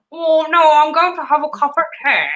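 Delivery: high, loud put-on British voice; teasing, exaggerated British accent, pitched high; boyfriend's mock British accent. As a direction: imitate someone hamming up a British accent. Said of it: "I'll tell you how he does this".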